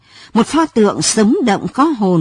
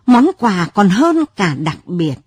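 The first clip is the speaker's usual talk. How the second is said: usual speaking voice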